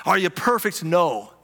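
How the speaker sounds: usual speaking voice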